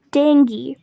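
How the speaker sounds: "dengue" as DENG-gee; hard g and ee ending on "dengue"; correct pronunciation. Shown as a demonstration.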